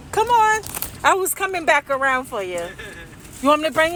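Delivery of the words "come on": high, coaxing call to come over. Real, not acted. Calling someone over to the car, high coaxing voice.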